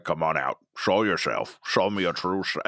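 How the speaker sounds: lightly voiced eerie mentor; low mystical voice. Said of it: "and he's like".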